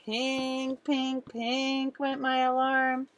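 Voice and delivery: slow sing-song alarm imitation; alarm ringing. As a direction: ring it out like an alarm clock. Alarm sound.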